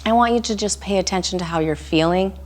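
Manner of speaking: calm meditation voice with long pauses; guided meditation. Real, not acted. Instructor guiding listeners through a body scan, calm meditation voice. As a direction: lead a body scan gently, leaving room to breathe.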